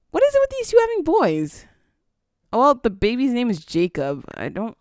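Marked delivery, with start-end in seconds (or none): 0.1-1.7 incredulous. Real, not acted. Another baby boy born.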